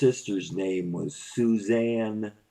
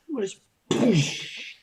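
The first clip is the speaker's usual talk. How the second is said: squeaky voice; 13 semitones higher, 4 dB louder than usual. Pretend. High put-on voice.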